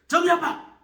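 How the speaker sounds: loud, high shout of a name; calling out. Acted. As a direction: burst through the door yelling her name. Deacon bursts in calling out.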